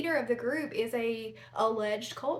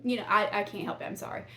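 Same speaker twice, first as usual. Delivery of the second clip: normal talking voice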